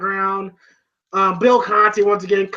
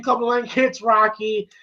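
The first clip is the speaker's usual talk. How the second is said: ordinary delivery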